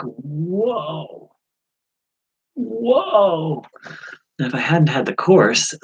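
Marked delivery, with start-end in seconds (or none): 0.0-3.6 startled whoa. Retold or imitated. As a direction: pull back in alarm. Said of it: "I was like".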